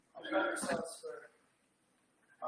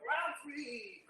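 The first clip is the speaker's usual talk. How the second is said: hyped up; 8 semitones higher, 5 dB louder, much slower than usual. Real, not acted. Instructor hyping class into round.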